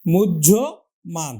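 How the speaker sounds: মুহ্য said as "mujjho" with a doubled j, then মান; pronunciation demo. Shown as a demonstration. Teacher repeats মুহ্যমান for students. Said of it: "তাহলে উচ্চারণ হলো কিন্তু".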